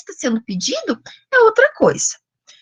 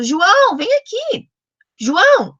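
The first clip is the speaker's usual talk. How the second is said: put-on high, raised call to a child; chamando criança; 8 semitones higher, 4 dB louder than usual. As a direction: call the boy over, high and loud. Acts calling a child by name. (chamando o menino, voz aguda e alta)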